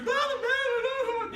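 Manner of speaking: very high, loud hysterical babble; imitated frantic crying. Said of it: "and crying".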